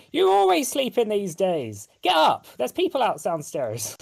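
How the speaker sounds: high, scolding wife nagging; berating scolding. Retold or imitated. Wife berating her sleepy husband to get up, high and scolding. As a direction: tell him off, nagging him up. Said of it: "berating the two kids, as well as berating the, uh, the father".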